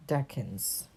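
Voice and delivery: plain reading voice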